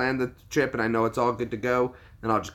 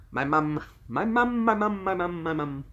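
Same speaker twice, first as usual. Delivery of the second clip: sing-song; 8 semitones higher than usual. Pretend. Playful sing-song repetition.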